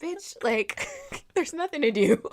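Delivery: high laughing sass; laughing retort. Retold or imitated. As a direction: laugh it out, exasperated. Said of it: "And I'm just like".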